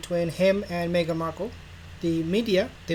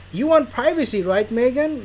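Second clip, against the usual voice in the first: mock Harry; 6 semitones higher, 6 dB louder than usual. Harry voiced coaxing Meghan.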